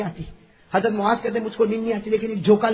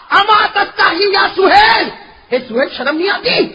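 angry rebuke; 12 semitones higher, 10 dB louder than usual. Retold or imitated. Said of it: "میں نے کہا".

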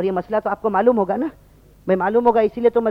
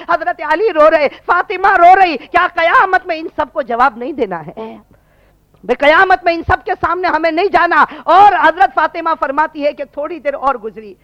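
raised, urgent pulpit voice; impassioned preaching; 6 semitones higher, 7 dB louder than usual. Acted. Preacher warning the congregation about Judgement Day, impassioned. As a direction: press it on the listeners with fervour.

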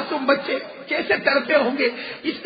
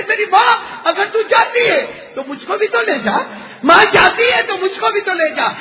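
put-on high, loud sobbing plea of a small child; crying plea; 6 semitones higher, 4 dB louder than usual. A narrator's reading. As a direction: beg through tears to go along. Little Hussain begs his mother. (روتے ہوئے بلند فریاد)

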